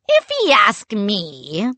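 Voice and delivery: slow growled pirate line. A narrator's reading. Pirate captain mocks the kids, slow growl. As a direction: growl it out, taking time. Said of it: "Jack growled".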